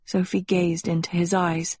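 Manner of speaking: plain reading voice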